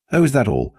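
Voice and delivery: amused excitement. Acted. Grin widens, cocky reply.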